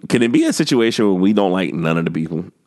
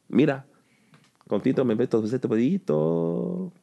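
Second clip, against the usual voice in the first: fake Spanish; 3 semitones higher, 5 dB quieter than usual. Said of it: "That's not Spanish".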